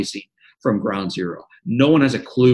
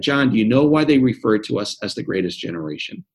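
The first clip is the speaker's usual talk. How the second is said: usual speaking voice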